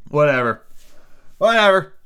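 loud frustrated rant; exasperated. Real, not acted. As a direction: vent at the absurd book. Reader exasperated at the book.

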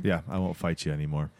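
low, soft resigned murmur; defeated. Retold or imitated. Defeated dad surrenders to his daughter, imitated low resignation. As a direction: imitate a beaten man giving in. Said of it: "the dad is kind of defeated".

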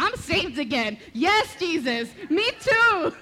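loud, rising hallelujah-style outburst; revival fervor. Retold or imitated. Reliving her response to fervent prayer, exuberant loud cry.

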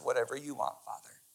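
whispered yielding prayer; hushed surrender. Acted. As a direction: let it go in a near whisper. Preacher voices Jesus yielding.

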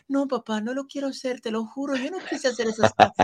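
put-on high, soft pleading young son. Young son begging his famous father, high and soft. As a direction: beg your dad not to make you, voice high and soft.

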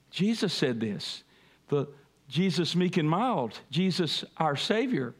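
usual speaking voice

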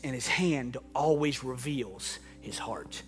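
low, quiet, tender preaching; soft closing sermon. Acted. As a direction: close the sermon gently over the music. Soft closing sermon over music.

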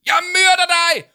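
very high, loud screamed threat; full-out shrieking yell; furious shout. Acted. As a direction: explode at her in rage. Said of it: "råbte Victoria".

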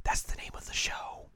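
whispered, no voice. Retold or imitated. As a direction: breathe it as a whisper. Contrasting take whispered.

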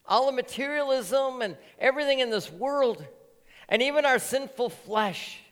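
high, loud pulpit preaching; a pastor urging the church not to lose Jesus, fiery and loud. Real, not acted. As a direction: preach it out to the congregation with full force. Preaching at full force.

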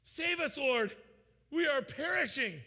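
high, loud desperate cry for help; terrified disciples crying out in the storm; panicked plea. Acted. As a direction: beg to be saved from drowning. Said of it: "these disciples, they went to him, saying".

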